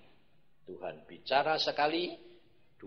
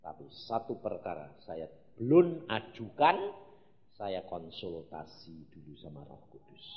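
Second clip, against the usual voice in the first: normal talking voice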